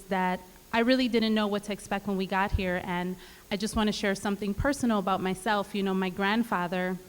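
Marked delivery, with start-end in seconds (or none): none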